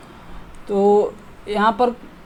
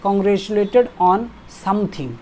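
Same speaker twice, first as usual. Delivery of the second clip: slow, stressing "congratulated"; slow model phrase; much slower than usual. Shown as a demonstration. Teacher modelling "congratulated on something" slowly. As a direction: read the phrase slowly and clearly. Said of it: "congratulated के साथ".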